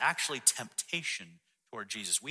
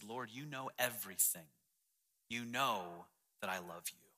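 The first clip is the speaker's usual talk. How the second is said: hurt; 2 semitones lower, 7 dB quieter than usual. A narrator's reading. Peter hurt at being asked a third time, quiet hurt. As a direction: answer softly, as if gently wounded. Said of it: "Peter was hurt".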